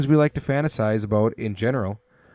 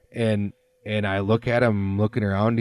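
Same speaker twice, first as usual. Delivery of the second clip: ordinary talk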